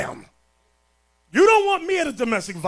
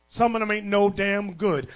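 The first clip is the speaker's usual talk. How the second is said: ordinary talk, nothing marked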